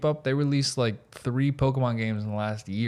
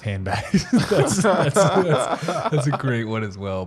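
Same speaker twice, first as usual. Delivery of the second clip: laughing through the words, higher and louder; 3 semitones higher, 5 dB louder, slower than usual. Real, not acted. Host amused by co-host's Japanese word, laughing. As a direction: crack up while trying to speak. Cracking up at handbags.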